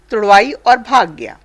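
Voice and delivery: ordinary talk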